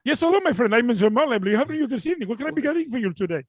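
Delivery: put-on Indian accent. Cable company agent greeting a caller, put-on Indian accent. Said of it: "someone from India".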